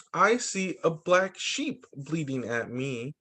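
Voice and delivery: ordinary talk, nothing marked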